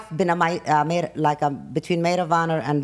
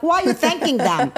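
exasperated high question; 8 semitones higher than usual. Retold or imitated. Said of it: "I'm like".

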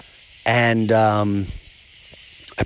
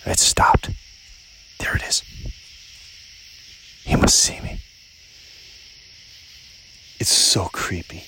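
hushed whisper; 8 semitones lower than usual. Real, not acted. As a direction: whisper while listening out for something. Still listening for the owl.

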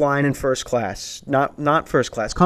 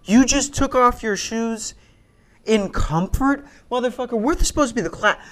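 pitched way up, outraged at the shoes; high indignant rant; 10 semitones higher than usual. Retold or imitated. Stand-up comic ranting about a shoeless seatmate, high and indignant. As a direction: squeak out your disgust.

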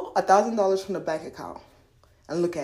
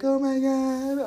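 high, drawn-out wail; lightly acted tearful moan; mock crying; 6 semitones higher, much slower than usual. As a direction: imitate someone weeping on your shoulder. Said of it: "motherfuckers in there crying".

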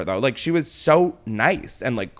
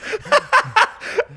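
shrieking laugh. Real, not acted. Bursts out laughing at the story.

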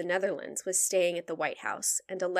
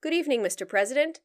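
a hint of Churchill, higher and quick; posh Churchill quip; 6 semitones higher, faster than usual. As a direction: deliver it like a dry quip. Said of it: "and said, quote".

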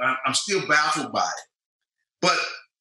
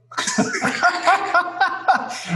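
big laugh; 14 semitones higher, 4 dB louder than usual. Real, not acted.

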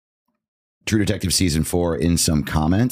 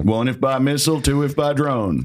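poem recital; 6 semitones higher than usual. Acted. Parody of Revere line.

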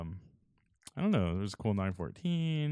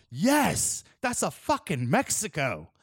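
hyped shout; 6 semitones higher, slower than usual. Retold or imitated. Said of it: "he's all".

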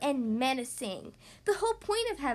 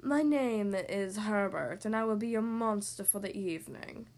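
low breathy monster; 6 semitones lower than usual. A narrator's reading. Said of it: "said a low, breathy voice".

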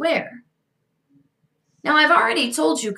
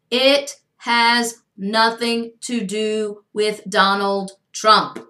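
slow, word-by-word emphasis; emphatic repetition; 3 semitones lower, much slower than usual. Real, not acted. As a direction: spell it out slowly, each word standing alone. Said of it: "let me repeat this".

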